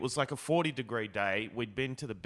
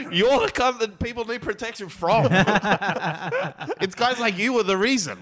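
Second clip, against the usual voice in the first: higher and louder, laughing through the words; laughing rant; 10 semitones higher, 9 dB louder than usual. Real, not acted. Mocking the gun guy while laughing, higher and louder. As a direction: rant while still cracking up.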